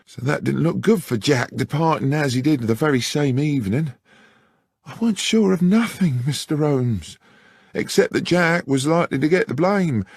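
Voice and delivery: a frightened maid pleading her case; high anxious country lass; young country woman. Acted. Beth explains to Holmes.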